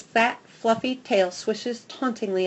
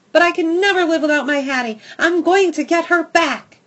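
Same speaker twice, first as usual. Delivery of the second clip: loud, high, fast furious outburst; 9 semitones higher, 9 dB louder than usual. Acted. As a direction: shout your resolve at them. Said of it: "a sense of fury rises".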